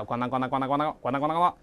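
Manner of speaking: rapid run of clanking noises; fast, repetitive sound-effect patter; imitated clattering. Shown as a demonstration. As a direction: rattle off the clatter of hard objects. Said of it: "这是个模拟声音的".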